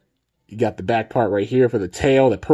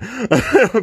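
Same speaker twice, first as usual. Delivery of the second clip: embarrassed laugh; 12 semitones higher, 4 dB louder than usual. Real, not acted. Laughing at his own squeak.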